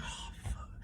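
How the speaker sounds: imitating a tired fighter with a voiceless gasp; spent, whispered mock exhaustion; mock winded. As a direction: puff it out softly as if out of breath. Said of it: "you could tell she a little tired".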